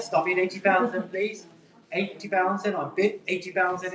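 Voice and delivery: ordinary talk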